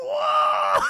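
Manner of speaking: high, loud "whoa" shriek; hammed-up terrified yell; frightened scream. Aunt Bam reading the haunted mirror, high scared shriek.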